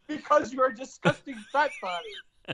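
quoting the sergeant's insult, laughing through it; laughing mock insult. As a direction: deliver the insult while cracking up. Drill instructor insult, laughing.

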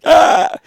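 high loud mock yelp; a sudden comic yelp; funny face cry. Imitating Jeff's funny face, a high loud yelp. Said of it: "Jeff always makes his face like".

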